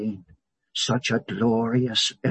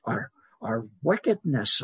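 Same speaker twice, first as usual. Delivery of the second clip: normal talking voice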